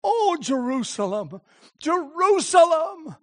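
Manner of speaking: slow rising cry of Jerusalem; anguished lament. Acted. Jesus weeping over Jerusalem, slow anguished cry. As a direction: cry out over the city in grief. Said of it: "And then he says".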